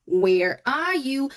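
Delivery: slow, lilting "Where are you"; singsong "Where are you", each word stretched; hide-and-seek call. Shown as a demonstration. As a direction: draw out "Where are you" like searching in a game. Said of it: "Like when you play hide and seek".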